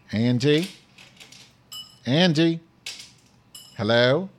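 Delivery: loud, questioning hail down the line; calling out. Real, not acted. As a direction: call out to someone who may not hear. Calling a caller on Skype.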